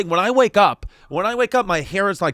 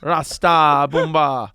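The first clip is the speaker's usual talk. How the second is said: put-on Jamaican accent; slower than usual. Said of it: "born and raised in Jamaica".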